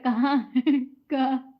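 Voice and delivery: a loud, annoyed "where is he?"; mock angry call. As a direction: call out for him with irritation. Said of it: "वो भैया गुस्सा रहे हैं". (गुस्से में ज़ोर से पुकारना)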